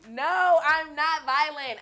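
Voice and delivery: high, loud, slow denial; loud protest. Real, not acted. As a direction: shout the denial, drawn out. Protesting loudly, laughing.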